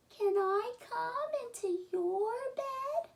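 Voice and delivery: high squeaky little mouse voice calling out. Acted. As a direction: call for help like a tiny scared mouse.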